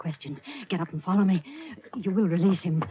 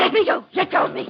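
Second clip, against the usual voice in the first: frantic struggling; 13 semitones higher, 6 dB louder than usual. Acted. Woman struggling against captors.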